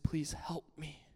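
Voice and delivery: soft, slow whispered prayer; whispered plea. Retold or imitated. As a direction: plead under your breath, slowly. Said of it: "And I just said".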